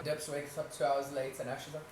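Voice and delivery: normal talking voice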